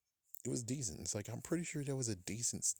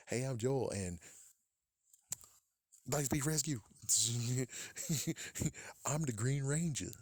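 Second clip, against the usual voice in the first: mock character boast; 5 semitones higher than usual. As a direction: ham up a boastful hero.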